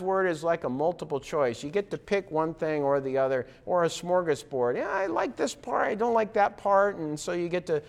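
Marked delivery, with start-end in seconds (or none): 4.8-7.0 high, flippant mock voice picking favourites; mock picky chooser. Mocking Christians who treat God's word as a smorgasbord, high and flippant. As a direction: toss it off like choosing at a buffet.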